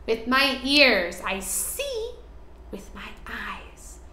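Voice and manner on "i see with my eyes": soft whispered line. Shown as a demonstration. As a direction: whisper it to the kids. Teaching senses, whispered.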